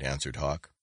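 usual speaking voice

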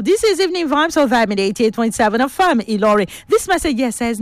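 ordinary talk, nothing marked

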